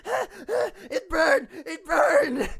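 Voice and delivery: high, loud pained goblin shrieks; goblin burned. Acted. Goblin screaming after chugging acid. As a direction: yelp like a goblin whose mouth is burning. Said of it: "freaks out and throws it".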